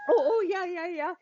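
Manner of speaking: high, flustered yeahs; a hint of a startled student; flustered young man.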